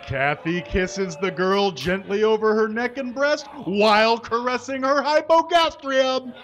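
ordinary talk, nothing marked

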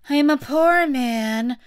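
loud, slow plea; drawn-out plea of a timid witness; pleading Hatter. A narrator's reading. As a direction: plead it slowly and plaintively.

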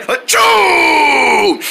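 hammed-up sneeze stretched to the limit; huge pretend sneeze. Sneeze cuts off Wrinkle Head, huge mock sneeze. Sneeze interrupts his sentence.

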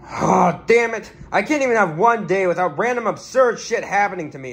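ordinary talk, nothing marked

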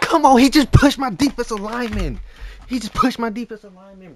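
high, loud outraged complaint; outraged yell. Real, not acted. Gamer furious his lineman got pushed, high outraged yell. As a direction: yell the injustice at the screen.

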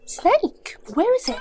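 high, loud startled questioning. Acted. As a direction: jump at the news in alarm. Startled by news of a snake.

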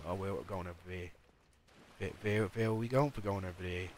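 mocking mumble with "there" said as "vair"; mispronounced th. Streamer mocks people dropping their th, "there" as "vair". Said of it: "can't pronounce the, the T H letters, the th sound".

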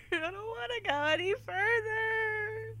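high whining plea; whimpering. Real, not acted.

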